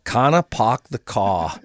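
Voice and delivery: slow New England "Kana, park the car", R's dropped; attempted New England accent. Host trying guest's phrase, slow New England accent. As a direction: carefully imitate New England, "car" as "caw".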